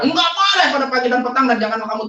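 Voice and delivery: high, loud retold lecture; emphatic admonition. Man retelling how he lectured his accuser on prayer, emphatic. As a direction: lay down the rule forcefully.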